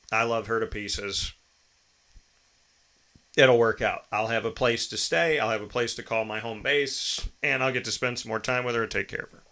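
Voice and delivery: ordinary delivery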